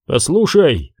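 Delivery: usual speaking voice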